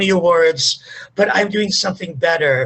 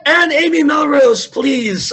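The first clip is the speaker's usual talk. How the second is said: higher, louder call to a participant; 7 semitones higher, 4 dB louder than usual. Real, not acted. Host to an online participant who needs to unmute, calling out. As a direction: raise the voice to get someone's attention.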